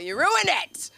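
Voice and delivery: high, loud comic blame; mock outraged accusation. Comics turning on their families, mock outraged. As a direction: throw the blame out in a hammed-up yell.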